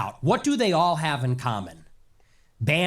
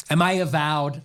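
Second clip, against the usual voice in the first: normal talking voice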